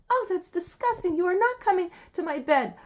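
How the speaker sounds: high, loud princess outrage; acted revolted princess; disgusted refusal. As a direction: refuse loudly, pitched well up. Princess refuses frog her bed.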